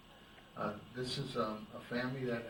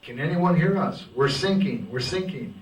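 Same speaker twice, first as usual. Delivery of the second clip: desperate shout; 4 semitones higher, 13 dB louder than usual. Acted. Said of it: "he says".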